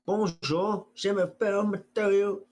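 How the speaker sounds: high, hammed-up French accent; french chef. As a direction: introduce yourself like a French chef. Mock French intro.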